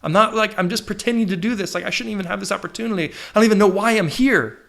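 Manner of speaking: high-pitched, flustered self-doubt; acted panic. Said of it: "panicking because I'm like".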